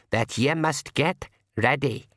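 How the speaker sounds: put-on slow, cracked old pixie voice. A narrator's reading. Old pixie relays the Kelda's word, slow cracked voice. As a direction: draw it out like a wizened old pixie. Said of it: "said a cracked voice by his ear".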